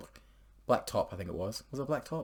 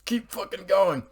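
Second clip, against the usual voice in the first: urgent plea; 9 semitones higher, 4 dB louder than usual. Retold or imitated. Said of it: "I'm like".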